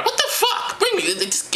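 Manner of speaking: high falsetto outburst; high-pitched comic outrage; outraged Mary. Acted. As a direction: burst out in a high woman's voice. Acting Mary outraged at gifts.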